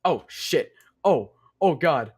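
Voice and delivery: startled by an attack mid-story; real startled alarm. Real, not acted. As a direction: gamer caught off guard, blurting out. Attacked suddenly in game.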